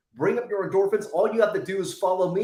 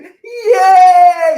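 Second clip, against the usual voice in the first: a big, high, much louder shout of joy; childlike yay shout; 11 semitones higher, 17 dB louder than usual. Shown as a demonstration. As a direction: cheer like a kid who just won. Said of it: "Use childlike laughter and go".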